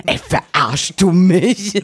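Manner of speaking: slow, high, incredulous 'are you kidding me'; mock indignation. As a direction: stretch it out in disbelief. Inner thought at absurd doctor question.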